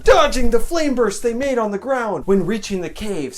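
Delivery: usual speaking voice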